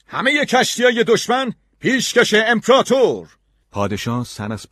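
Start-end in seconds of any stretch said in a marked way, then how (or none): 0.1-3.3 high, full-voiced triumphant shout; loud proclamation. Gulliver presents the captured ships to the emperor, loud high shout. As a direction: call it out loud to the whole shore. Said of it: "با صدای بلند گفتم".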